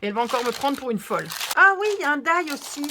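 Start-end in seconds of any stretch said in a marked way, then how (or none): 1.5-2.9 high, loud burst of delight; giddy, raised voice; silly little excited voice. Real, not acted. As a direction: squeak with glee at another find. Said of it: "je recommence avec ma petite voix débile".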